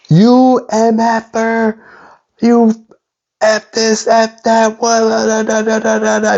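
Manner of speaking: high-pitched mock angry cursing; retold cursing. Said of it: "cursing at me".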